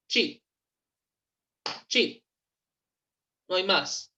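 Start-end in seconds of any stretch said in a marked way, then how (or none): 0.1-0.4 "Cheap" said in one single beat; cheap en una sílaba. Shown as a demonstration. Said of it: "Es en un solo golpe". 1.9-2.2 "Cheap" said in one single beat; cheap en una sílaba. Shown as a demonstration. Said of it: "Es en un solo golpe".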